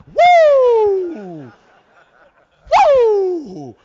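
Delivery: huge, high, long whoop; celebratory whoop. Retold or imitated. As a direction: whoop at full volume in mock triumph. Son's whoop, imitated.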